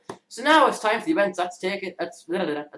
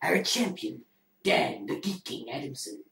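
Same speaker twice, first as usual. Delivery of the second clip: low, hushed movie trailer voice; bad movie voice; 5 semitones lower, 4 dB quieter than usual. Quiz master introducing himself and the champion, bad low movie voice. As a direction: put on a poor trailer narrator, dropping deep.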